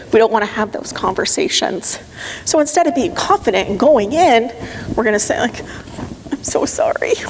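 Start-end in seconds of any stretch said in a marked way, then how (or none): 6.3-7.1 breathy little apology you barely dare say; a hushed, sheepish sorry; timid whispered apology. Retold or imitated. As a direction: whisper it meekly, almost ashamed.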